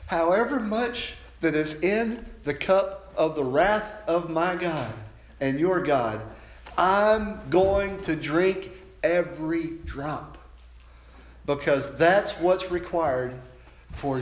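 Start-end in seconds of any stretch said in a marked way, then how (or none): 0.1-10.4 slow, climbing preaching voice; building resolve. Acted. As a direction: press each word harder as it builds. Preacher voicing Jesus, building.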